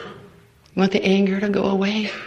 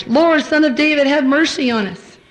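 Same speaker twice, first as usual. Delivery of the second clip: louder, higher desperate cry; shouting louder; 7 semitones higher, 5 dB louder than usual. Retold or imitated. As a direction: call out even harder over the crowd. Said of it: "they shouted all the louder".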